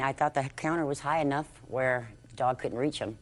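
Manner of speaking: ordinary talk, nothing marked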